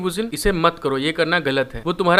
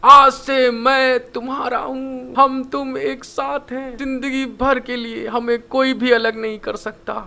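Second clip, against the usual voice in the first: higher, louder and slow, a drawn-out shouted vow; 5 semitones higher, slower than usual. Acted. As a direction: bellow the promise of love slowly. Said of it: "फिर बहुत तेज चिल्ला के बोलता है". (ऊँची, धीमी, ज़ोरदार चीख)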